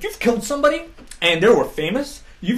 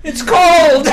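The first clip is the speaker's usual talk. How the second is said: high, loud wail of being cold; loud, high comic wail; mock freezing cry; 7 semitones higher, 8 dB louder than usual. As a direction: cry out like a freezing man, hammed up. Acting out the man in freezing snow.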